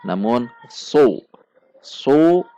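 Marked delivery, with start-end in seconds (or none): 0.7-1.2 su with thick raised tongue root; heavy sad. Shown as a demonstration. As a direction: darken su with the back of the tongue. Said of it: "huruf menjadi tebal".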